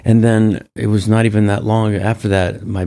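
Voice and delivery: ordinary talk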